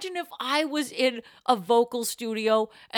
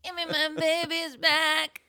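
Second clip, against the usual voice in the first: pull string toy; 6 semitones higher, slower than usual. Retold or imitated. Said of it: "the Brittany goes".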